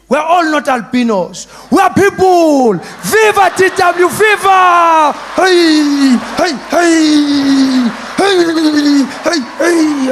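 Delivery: very loud, high shouting chant; rally the crowd; rallying cheer. Retold or imitated. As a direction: friends cheering him like a protest march.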